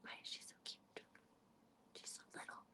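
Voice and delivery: soft adoring whisper; voiceless whisper of adoration; whispering over cute cat. Real, not acted. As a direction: whisper like you might wake her.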